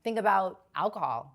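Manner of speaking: ordinary talk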